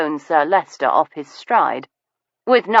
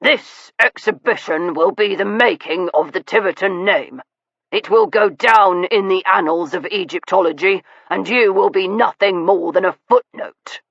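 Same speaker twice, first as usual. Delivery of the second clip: through clenched teeth; 3 semitones higher than usual. A narrator's reading. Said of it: "through clenched teeth".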